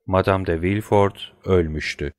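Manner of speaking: ordinary delivery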